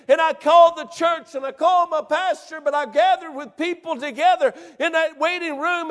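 rising to a loud, high-pitched preacher's cry; loud revival preaching, pitched high and urgent; preaching at full force. Acted. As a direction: build the testimony to a shout.

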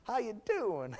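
a light Southern drawl with a laugh; fondly imitating grandpa's Southern hello; soft laughing Southern Papa voice; Papa's southern greeting. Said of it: "Papa was that he would say".

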